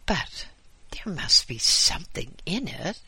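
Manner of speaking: high, insistent fussy voice; insistent visitor. Acted. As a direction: press the point eagerly.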